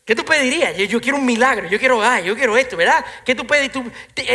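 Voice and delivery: high, loud preacher's challenge; preaching at full force. Real, not acted. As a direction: press the congregation with the question. (predicando con fuerza, voz alta)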